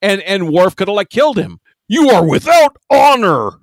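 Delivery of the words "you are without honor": stern Klingon warrior. Retold or imitated. Acting out Worf's Klingon disdain.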